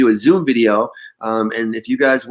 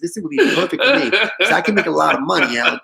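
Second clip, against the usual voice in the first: higher-pitched mock New York accent through a laugh; 6 semitones higher than usual. Boss imitating a New York caller pitching himself, laughing New York accent. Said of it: "The guy sounded like that".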